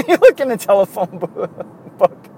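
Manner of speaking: laughing. Real, not acted. Host asks listeners for help, cracking up mid-sentence. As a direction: laugh right through the words. Laughs at own outdated suggestion.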